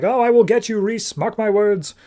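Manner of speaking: high-pitched mock threat called out; calling out. Batgirl calls out to Reese, mock threatening call. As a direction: call after a fleeing foe, pitched well up. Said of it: "calls out to Reese".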